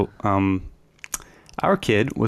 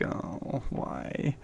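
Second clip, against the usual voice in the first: whispered sigh; 4 semitones higher, 12 dB quieter than usual. Retold or imitated. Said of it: "it's like".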